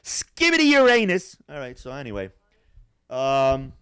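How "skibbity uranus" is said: silly high shout. Pretend.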